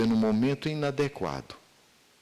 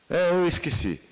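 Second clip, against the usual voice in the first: voz de menina, mais aguda e mais alta; filha sem jeito; 6 semitones higher, 3 dB louder than usual. Retold or imitated. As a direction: responde como criança disfarçando. Filha responde ao pai. (filha de sete anos respondendo ao pai)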